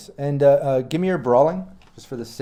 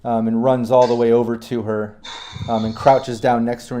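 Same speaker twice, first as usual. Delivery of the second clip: ordinary talk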